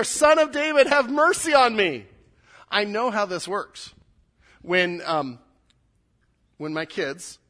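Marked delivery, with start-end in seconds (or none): 0.0-2.1 loud, high-pitched cry for mercy; yelling. Acted. Blind man cried out all the more, yelling. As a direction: yell the plea out over the crowd. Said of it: "he starts just yelling".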